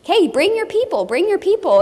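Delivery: high, bright urging; cheerfully rally the group; high, bright call to bring friends; upbeat invitation. Retold or imitated. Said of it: "You probably can tell this story".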